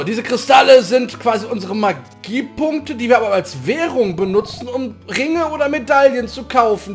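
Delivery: normal talking voice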